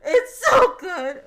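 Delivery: overwhelmed gush. Real, not acted. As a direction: wail it out in delight. Gushing louder, crying out.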